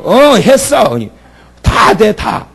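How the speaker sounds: 전라도 사투리로 대답; Jeolla dialect. Retold or imitated. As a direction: answer like a Jeolla local. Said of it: "전라도 사투리".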